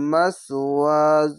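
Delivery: মাসওয়াজ slow, letters joined; joined recitation. Shown as a demonstration. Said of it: "এই জয় জঙ্গে মিলবে".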